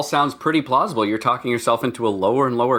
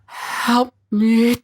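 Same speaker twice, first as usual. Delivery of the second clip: higher, eerie ghostly plea; pleading ghost voice; 8 semitones higher, much slower than usual. Acted. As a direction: wail for help like a fading spirit. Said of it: "like my ghost voice?".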